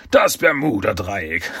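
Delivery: slow reveal breaking into villain laughter; gloating villain. Acted. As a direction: announce it slowly, then cackle. Schurke enthüllt seinen Plan. (langsam enthüllt, dann hämisches Lachen)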